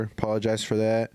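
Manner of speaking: normal talking voice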